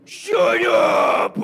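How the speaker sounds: sudden explosive rasping scream; Adam Sandler. Acted. Comedy actor begged to do a sequel, annoyed outburst. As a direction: blow up into a harsh, very loud yell. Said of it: "this is my impression of Adam Sandler".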